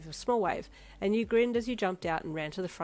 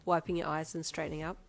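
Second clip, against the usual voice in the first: usual speaking voice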